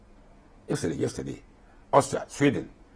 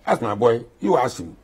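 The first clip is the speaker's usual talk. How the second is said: usual speaking voice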